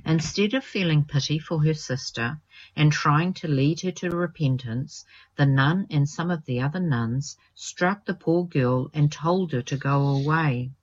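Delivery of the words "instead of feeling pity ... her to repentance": usual speaking voice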